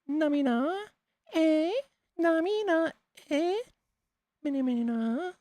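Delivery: put-on very high, squeaky anime girl. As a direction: chirp it like a cute anime girl. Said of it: "Like the little anime girl's voice".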